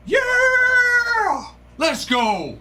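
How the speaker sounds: huge high yell of joy; excited shout. Real, not acted. Streamer yelling to chat over a big hit, overjoyed. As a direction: erupt with delight at the pull.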